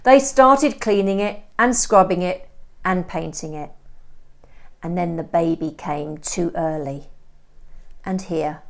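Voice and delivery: steady first-person storytelling voice; story narration. A narrator's reading. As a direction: read it like a gripping novel chapter. Teacher reads novel chapter aloud.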